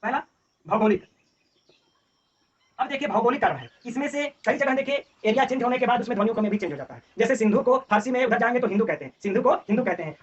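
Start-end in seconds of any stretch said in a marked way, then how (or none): none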